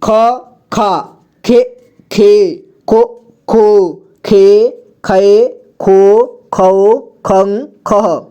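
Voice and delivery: slow ख series, each syllable apart; syllable drill. Shown as a demonstration. Teacher recites ख series so learners can read, slow drill. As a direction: recite each syllable, drawing out the long vowels. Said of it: "अब ख का देखिए".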